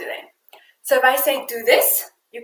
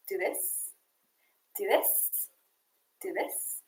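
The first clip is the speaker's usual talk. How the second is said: breathy whispered "Do this"; 5 semitones higher, 13 dB quieter, much slower than usual. Shown as a demonstration. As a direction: drop "Do this" to a soft whisper. This-and-that game demo.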